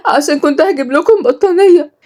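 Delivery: high, tearful reproach. A narrator's reading. Injured servant blames the girl, tearful. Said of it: "ردت رباب بدموع".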